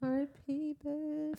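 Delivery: high, soft mock farewell; mock mourning. Real, not acted. Mock eulogy for character.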